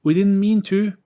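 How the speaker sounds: high, loud scared apology; plead, frightened; frightened plea. Acted. William pleads with the angry ghost, high and loud.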